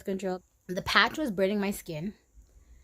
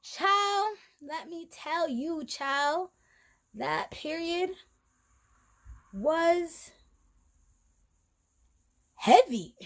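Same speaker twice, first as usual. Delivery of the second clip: dramatic; 7 semitones higher, 5 dB louder, much slower than usual. Real, not acted. Dramatic emphasis on heavy period.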